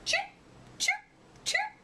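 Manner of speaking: short breathy chirps; grasshopper chirp. Acted. Grasshopper answering the owl with quick chirps. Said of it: "he said".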